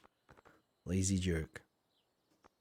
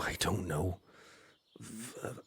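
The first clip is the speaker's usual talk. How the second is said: usual speaking voice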